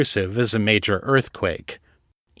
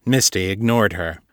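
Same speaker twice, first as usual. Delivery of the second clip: usual speaking voice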